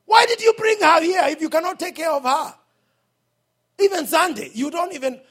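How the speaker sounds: loud, high scolding; loud rebuke. Real, not acted. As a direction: call the parent out from the pulpit. Rebuking the overworked parent.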